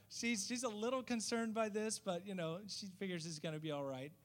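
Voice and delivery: usual speaking voice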